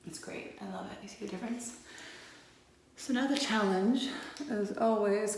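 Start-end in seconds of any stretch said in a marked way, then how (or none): 0.1-1.8 whisper. Real, not acted. Hushed aside while applying liner.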